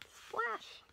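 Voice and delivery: high whispered splash. A narrator's reading. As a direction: breathe the splash out as a sound effect. Sound word in story.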